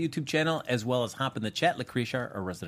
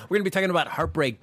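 ordinary talk, nothing marked

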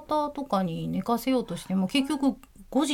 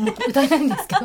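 laughing; 4 semitones higher, 7 dB louder, much faster than usual. Real, not acted. Laughing embarrassed.

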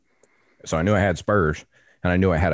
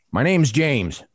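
higher, raised-voice reply; retold calling back; 8 semitones higher, slower than usual. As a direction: call it back while walking away. Hunter calls back while walking off.

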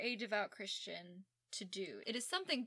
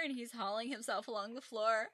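usual speaking voice

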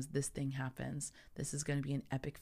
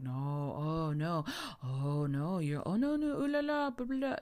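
imitating French speakers; 2 semitones higher than usual. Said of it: "I didn't speak any French".

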